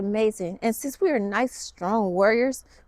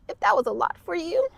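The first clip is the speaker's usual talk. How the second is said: ordinary talk, nothing marked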